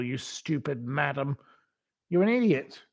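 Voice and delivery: ordinary talk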